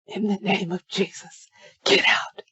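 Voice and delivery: terrified breathless whisper, no voice at all; hissed whisper. Retold or imitated. Terrified narrator hissing at the figures at his bed, whispered. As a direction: hiss it out under the breath. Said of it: "I hiss at them in a whisper".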